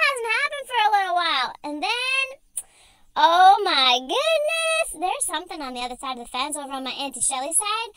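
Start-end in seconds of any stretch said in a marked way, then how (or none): 3.2-4.9 drawn-out surprise. Acted. Dog persona alarmed by noise.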